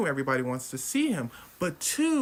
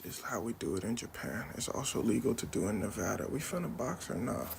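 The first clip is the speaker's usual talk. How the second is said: cool low monster; 6 semitones lower, 6 dB quieter than usual. Retold or imitated. Monster answers coolly.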